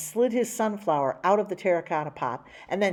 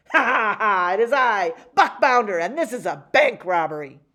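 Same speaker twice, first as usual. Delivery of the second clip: loud, swaggering villain laugh and boast; gloating villain; 3 semitones higher, 7 dB louder than usual. Acted. Robber announces himself to the bank, loud gloating boast. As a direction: crow like a pantomime villain.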